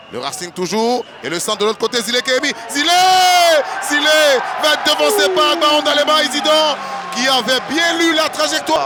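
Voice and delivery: loud, rising match call; shouted play-by-play. Real, not acted. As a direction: shout the name as he runs. Live chance called loudly.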